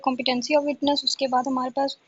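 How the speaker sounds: ordinary delivery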